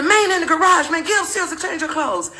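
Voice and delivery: high, strained mock order; retold urgent anger; angry husband. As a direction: imitate an exasperated husband giving orders. Retold yelling husband continues.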